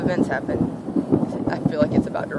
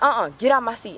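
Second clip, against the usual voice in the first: sassy order; 6 semitones higher than usual. Retold or imitated. Imitating girl ordering her out.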